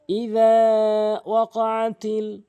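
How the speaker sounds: slow, drawn-out chanted Arabic; slow recitation. Shown as a demonstration. Teacher demonstrating the phrase, slow chant. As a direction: intone each syllable slowly.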